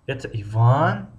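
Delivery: Иван stretched out with the pitch climbing at its end; slow, rising finish on Иван. Shown as a demonstration. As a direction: draw out Иван and lift it like a question. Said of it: "охири ҷумларо мо зиёдтар талаффуз".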